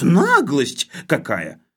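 loud, high, fierce indignation; bloodthirsty demand. Acted. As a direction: demand heads roll. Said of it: "кровожадно потребовал Хлебоводов".

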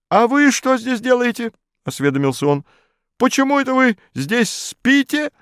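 high officious questioning; pompous bureaucrat. A narrator's reading. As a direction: demand answers like an official. Modest interrogates narrator.

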